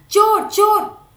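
high, loud shout of alarm; loud alarmed cry. A narrator's reading. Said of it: "वह जोर से चिल्ला पड़ा".

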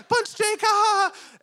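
put-on high, giggly child's laugh; giggling little girl. Dad imitating his giggling daughter, high-pitched and laughing. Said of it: "she was like".

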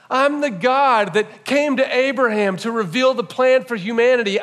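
fervent pulpit proclamation; preaching at full force. Acted. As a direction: thunder each I am like a revival preacher.

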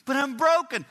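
high, loud plea; anguished protest. Acted. Broken man arguing with God, anguished protest. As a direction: cry out in protest.

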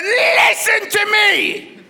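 high, loud, drawn-out mock shriek; angry wife. Angry wife in the car ordering husband to listen, high furious shout. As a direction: screech it slowly in comic fury. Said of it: "She was angry".

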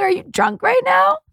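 high-pitched shocked question; incredulous. Retold or imitated. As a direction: question it in a squeaky, retold disbelief. Alarmed reaction to drinking remark.

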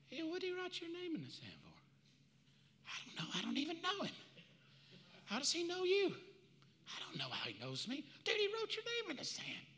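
soft, hushed worried chatter; hushed accusers conferring; nervous aside. Acted. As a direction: murmur it so he can't hear. Accusers whispering among themselves.

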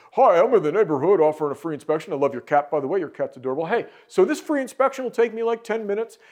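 high, gushing door-to-door pitch; overeager door salesman. Retold or imitated. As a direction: rattle it off like a nervous rookie. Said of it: "vomit out some solution like".

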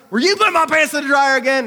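loud, high mock accusation to his wife; hammed-up husband demanding answers.

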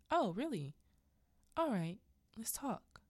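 acted mocking drawn-in interest; mock menace. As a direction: toy with a cornered victim, voice swooping. Menacing husband mocks her.

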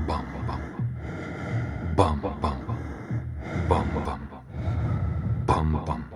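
low soft thuds like a pounding heart; drum beat imitation. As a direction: tap out each beat, slow and heavy. (тихое глухое «бам-бам», как удары сердца)

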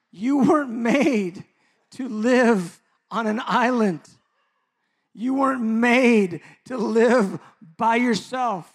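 loud, slow, high-pitched preaching; preaching at full force. Acted. Pastor exhorting his congregation, full-force preaching. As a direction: proclaim it to the whole room.

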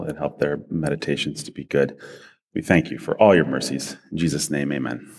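low, hushed prayerful voice. Acted. Opening prayer before sermon.

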